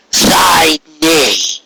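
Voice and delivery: high, slow, imperious royal decree; regal demon queen. Acted. Returned queen demanding the village present itself, imperious. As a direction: command the cowering ponies like a returned tyrant.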